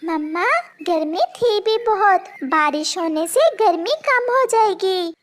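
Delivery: lightly voiced little chick; a small child chatting with mum. Chick to his mother about the heat, lightly voiced child.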